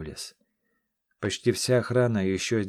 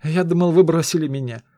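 much higher, louder glad outburst; joyful relief; 8 semitones higher, 10 dB louder than usual. Acted. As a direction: burst out happy he came back. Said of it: "радостно".

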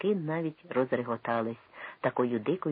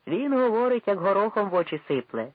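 loud, high admiring exclamation; excited praise; 7 semitones higher, 5 dB louder than usual. A narrator's reading. A Cossack in the crowd cheers Sagaidachny loudly. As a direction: cheer the speaker on.

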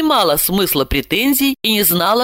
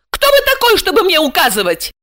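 very high, loud indignant challenge; outraged yell; 12 semitones higher, 4 dB louder than usual. A narrator's reading. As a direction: cry out, deeply offended. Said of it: "завопила она". (вопит возмущённо)

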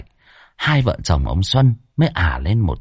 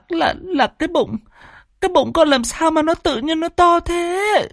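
put-on much higher, tearful teenage girl pleading with her father; whimpering schoolgirl. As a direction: plead like a frightened young girl, voice raised high. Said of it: "mếu máo".

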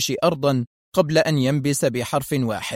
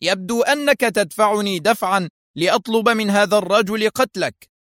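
higher-pitched, irate threat; exasperated; 7 semitones higher than usual. A narrator's reading. Foster threatens Arthur, infuriated. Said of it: "هتف فوستر محنقا".

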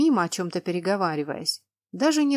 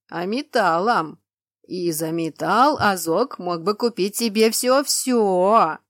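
slow, louder rumbling voice; slow giant voice; 5 dB louder, slower than usual. Acted. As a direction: rumble it out slowly. Cyclops explains.

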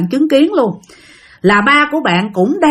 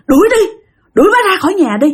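high, louder retold order; agitated demand; 10 semitones higher, 4 dB louder, faster than usual. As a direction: act out the husband insisting, pitched way up. Husband repeats demand to expel her. (giọng chồng gắt lên đòi đuổi đi)

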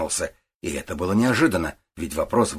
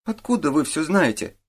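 put-on higher-pitched boy, taken aback; startled challenge; 9 semitones higher than usual. A narrator's reading. Boy startled that the detective knows everything, bristling. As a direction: bristle lightly with surprise. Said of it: "вскинулся Олег".

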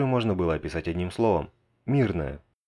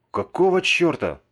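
high, loud and slow; shocked exclamation; 10 semitones higher, 5 dB louder, slower than usual. Acted. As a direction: blurt it out in horror. Narrator shocked by carnage. (потрясённый возглас)

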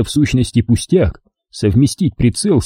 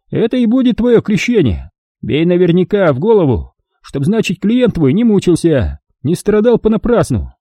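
put-on high, gloating old man voice; a cruel old miner mocking his victim; old prospector; 7 semitones higher than usual. A narrator's reading. Old man instructs Goncharov.

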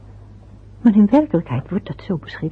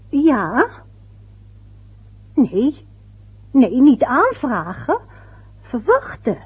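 ordinary talk, nothing marked

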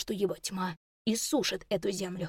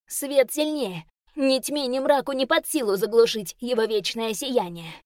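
higher, louder proud declaration; defiant proclamation; 4 semitones higher, 5 dB louder than usual. Acted. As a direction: proclaim the light's strength. Elf answers demon defiantly.

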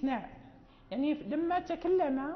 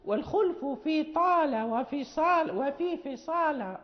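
recited verse; 6 dB louder than usual. Shown as a demonstration. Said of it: "فقال".

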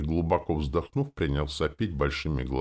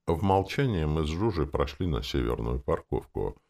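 ordinary delivery, nothing marked

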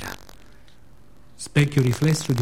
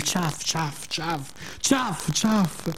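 splash noises; 6 dB quieter, much slower than usual. Acted. Imitating splashing in puddles.

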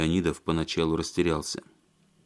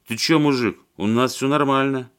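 put-on drunk thug; 6 semitones higher, 4 dB louder than usual.

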